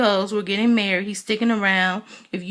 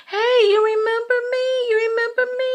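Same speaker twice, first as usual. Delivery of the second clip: much higher, sugary coaxing; sweet grandma voice; 3 dB louder than usual. Retold or imitated. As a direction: mimic Mama Joyce coaxing the little boy. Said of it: "She's like".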